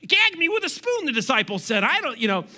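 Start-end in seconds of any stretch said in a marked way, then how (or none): 0.1-1.1 put-on much higher, sing-song Valley Girl accent. Pastor joking about a Valley Girl Bible, Valley Girl voice. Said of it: "read to you in Valley Girl".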